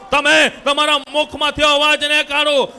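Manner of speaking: ordinary talk, nothing marked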